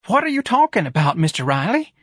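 high-pitched, alarmed plea; frightened. Acted. Office manager questioning Ignatius's accusation, frightened. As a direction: ask in a high, scared voice. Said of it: "in a frightened voice".

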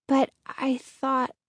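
slow, hesitant, fading away; halting, unfinished protest; trailing off. A narrator's reading. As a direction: let the thought peter out slowly. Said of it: "her voice trailed off".

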